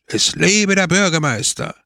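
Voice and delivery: high-pitched rallying cry; enthusiastic cheer. A narrator's reading. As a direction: hail him like an excited crowd. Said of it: "brachen in Begeisterung". (begeisterter Hochruf)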